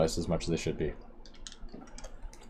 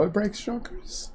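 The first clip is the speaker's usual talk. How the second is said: voice crack; 11 semitones higher, 4 dB quieter, slower than usual. Real, not acted. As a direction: voice cracking at the end. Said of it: "was a voice crack".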